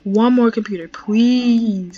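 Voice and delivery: loud, drawn-out plea; pleading. Real, not acted. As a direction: beg loudly, stretching out the please.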